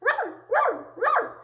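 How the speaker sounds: high, loud squeaky yaps; relentless little dog yapping; imitated puppy barking. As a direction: bark like a tiny, squeaky puppy. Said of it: "didn't stop barking the entire night".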